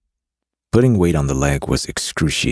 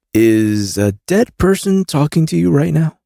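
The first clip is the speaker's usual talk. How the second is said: higher male voice, nauseous; a queasy smuggler; Carrion voice; 13 semitones higher, 5 dB louder than usual. A narrator's reading.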